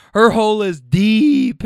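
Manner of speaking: loud, high, drawn-out imitation; teasing friend. Another passenger teasing her friend, loud mock declaration.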